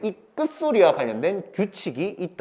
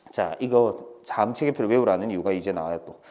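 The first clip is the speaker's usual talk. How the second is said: normal talking voice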